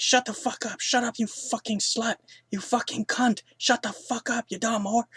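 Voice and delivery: mock screaming. Dying woman screaming insults, hammed-up high shriek. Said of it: "she's screaming".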